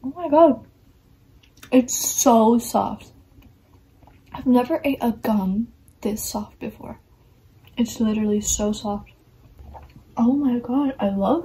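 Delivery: talking between chews; chewing gum while talking. Real, not acted. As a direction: mouth partly full of gum. Seen 0: Chews visibly, cheek bulges, hand over mouth while talking.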